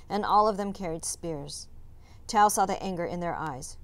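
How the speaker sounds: plain reading voice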